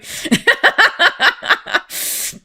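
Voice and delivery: high airy laugh; breathless giggle. Real, not acted. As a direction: let a breathy giggle escape. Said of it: "I get excited".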